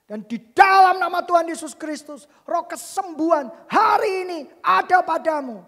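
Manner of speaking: high, loud declaration of healing; re-enacted forceful healing command; shouted prayer. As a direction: proclaim it at the top of the voice. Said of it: "Saya teriak seperti itu".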